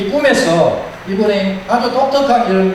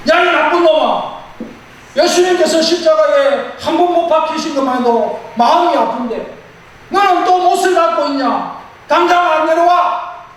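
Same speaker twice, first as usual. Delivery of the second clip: high, furious shouted rebuke; angry shout; 10 semitones higher than usual. Acted. Booth shouting at the man hammering nails into Jesus, enraged. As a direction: yell at him to come down now. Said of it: "이렇게 고함을 질렀습니다".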